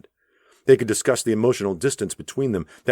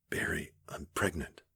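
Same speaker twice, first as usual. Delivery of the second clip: hushed confession; 12 dB quieter than usual. A narrator's reading. Confession of pregnancy.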